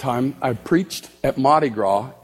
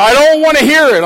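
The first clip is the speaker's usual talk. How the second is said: loud, high angry outburst; angry yell; 11 semitones higher, 11 dB louder than usual. Retold or imitated. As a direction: yell it, fed up. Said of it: "He said".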